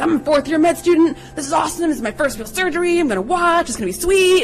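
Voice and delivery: high, bouncy imitation of an eager student; overexcited med student. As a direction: gush like a thrilled beginner. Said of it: "really getting excited about it".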